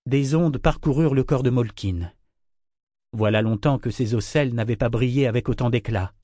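ordinary delivery

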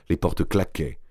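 plain reading voice

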